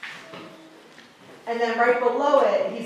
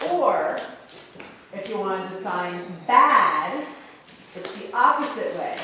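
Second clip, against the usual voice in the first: usual speaking voice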